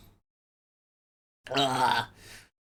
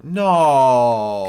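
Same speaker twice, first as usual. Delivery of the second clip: firm loud no; loud refusal; 2 semitones higher, 9 dB louder than usual. Real, not acted. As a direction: shout the refusal. Refusing to spin the wheel.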